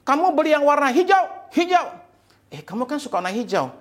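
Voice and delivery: high-pitched outraged accusation; very angry husband. Acted. Husband scolding wife over the green shirt, furious. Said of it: "Suaminya marah sekali".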